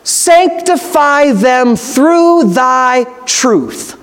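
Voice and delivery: very slow, drawn-out words; deliberate scripture quotation. Real, not acted. Preacher quoting John seventeen to the congregation, slow and deliberate. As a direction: stretch each word of the verse.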